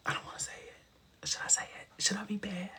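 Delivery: soft unvoiced whisper; embarrassed whisper. Real, not acted. Vlogger whispers to viewers, too embarrassed to say it aloud. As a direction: whisper it like a naughty secret. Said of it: "I'm embarrassed now".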